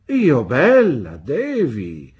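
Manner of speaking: loud, drawn-out, swooping disbelief; incredulous exclamation. A narrator's reading. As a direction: stretch it out in flattered astonishment. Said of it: "esclamò Pigoti".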